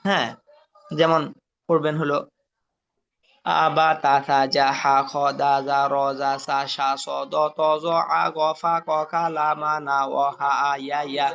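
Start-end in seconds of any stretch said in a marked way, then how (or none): 3.5-11.3 chain the syllables with no pause; continuous level run of syllables on one breath. Shown as a demonstration. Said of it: "এক নিঃশ্বাসে বলতে চেষ্টা করবেন". (এক নিঃশ্বাসে টানা উচ্চারণ)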